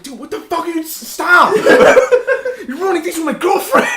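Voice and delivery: high, laughing plea; panicked laughing protest. Pretend. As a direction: squeal it out between laughs. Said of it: "I was like".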